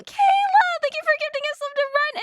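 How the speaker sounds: squealing name, high and loud; excited shout. Real, not acted. Streamer thrilled at a gifter, shouting her name. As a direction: call out the name with delight.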